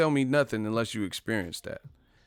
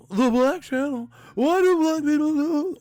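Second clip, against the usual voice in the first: much higher, louder, slow mock whine; high mocking put-on voice; mocking skeptic voice; 7 dB louder than usual. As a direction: lampoon a dismissive viewer in a squeaky put-on voice. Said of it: "think people are making it up".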